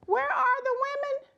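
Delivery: put-on high, indignant little girl voice; a sassy kid demanding an answer; hammed-up sassy girl. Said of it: "she's like".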